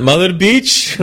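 put-on high, lilting Indian accent. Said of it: "I have to do it in the Indian accent".

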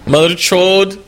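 put-on high, lilting Indian accent. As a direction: insult someone in an Indian accent. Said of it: "I have to do it in that way".

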